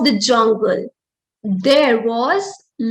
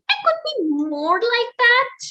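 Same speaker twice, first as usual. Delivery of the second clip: high, quick little mouse thinking aloud; a wistful squeak of a wish; tiny mouse voice; 9 semitones higher, faster than usual. Acted. Tiny mouse admiring the lion, high quick voice. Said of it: "who thought".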